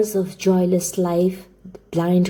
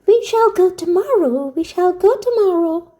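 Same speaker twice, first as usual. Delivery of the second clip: put-on high, gleeful child's cry; joyful crying out; 11 semitones higher, 4 dB louder than usual. A narrator's reading. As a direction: cry it out for joy like an excited little girl. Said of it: "skipping round the room for joy, crying out".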